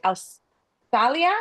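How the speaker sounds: AUSTRALIA attempted slowly as "ows-TAH-lia", rising; unsure pronunciation. Real, not acted. Host asking the call about a German name, AUSTRALIA said as questioning "ows-TAH-lia". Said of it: "how do you pronounce it?".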